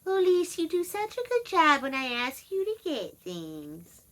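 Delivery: put-on high, sugary old-mother voice, slowed; imitating mother. Daughter mimicking her ninety-nine-year-old mother's sweet praise, high. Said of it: "she's been making sure to say".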